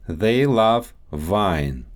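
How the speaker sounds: Russian accent, "wine" said as "vine". Shown as a demonstration. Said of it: "W and V sounds".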